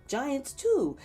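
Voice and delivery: usual speaking voice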